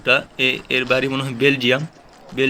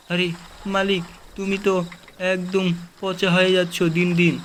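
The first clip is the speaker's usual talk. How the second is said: pet's voice; 3 semitones higher than usual. Pretend. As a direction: whine like a neglected pet to its owner. Voicing pet complaining to owner. (পোষা প্রাণীর উঁচু অভিমানী গলায় মালিককে বলা)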